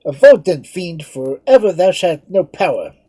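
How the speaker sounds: high, loud, defiant declaration; defiant exclamation. Acted. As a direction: hurl defiance at a fiend. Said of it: "exclaimed Lord Edmund".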